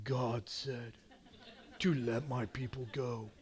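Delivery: very low, hushed and weighty prophet voice; Moses. Acted. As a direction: drop deep and quiet, solemn command to a ruler. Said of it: "he probably said it more like".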